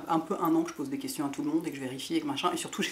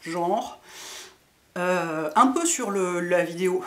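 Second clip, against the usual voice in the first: ordinary delivery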